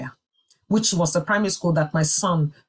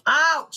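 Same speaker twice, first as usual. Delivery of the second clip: imitated ouch; 9 semitones higher than usual. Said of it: "She was ouching".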